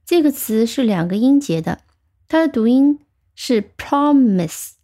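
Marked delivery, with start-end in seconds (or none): 3.8-4.7 promise, slow, with the first syllable PRO stressed. Shown as a demonstration. Teacher showing learners how the word is pronounced, promise stressed on PRO. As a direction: say promise slowly, leaning on PRO. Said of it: "重音是在第一音节的".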